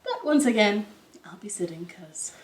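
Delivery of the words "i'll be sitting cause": softer breathy aside; whispered aside. Real, not acted. Teacher quietly explaining why she sits. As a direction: drop to a whisper.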